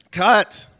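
sudden loud yelled cut; shouted cut. Retold or imitated. Director yelling cut at the actor on set. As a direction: yell it to stop the take dead.

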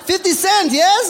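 loud, very high mock-eager question; excited fans. Young local asking the traveller about [name], mock excitement.

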